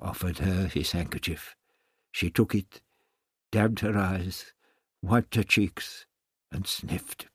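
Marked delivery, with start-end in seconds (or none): none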